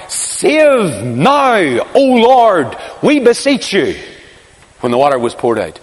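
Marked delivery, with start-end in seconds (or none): 0.1-4.0 raised, chanting cry of a great crowd. Retold or imitated. Preacher voicing the pilgrims' cry at the altar, raised chant. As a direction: call it out like thousands at the feast. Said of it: "would cry out".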